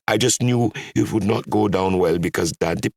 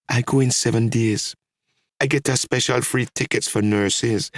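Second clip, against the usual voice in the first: higher, tearful answer; through tears; 6 semitones higher than usual. A narrator's reading. Daughter answers Dad without looking at him, tearful. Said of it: "I answered through my tears".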